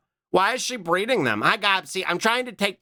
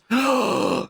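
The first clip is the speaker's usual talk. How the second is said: mock gasp; 8 semitones higher than usual. Mock shock at insult.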